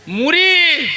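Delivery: high, drawn-out answer to the crowd; calling out. Real, not acted. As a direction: sing the word out high, then let it fall.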